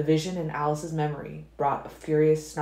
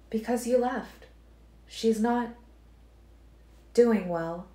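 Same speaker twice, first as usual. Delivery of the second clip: put-on Alice telling Edward how the girl suffers; Alice, sorrowful; 7 semitones higher, slower than usual. A narrator's reading. Alice voiced.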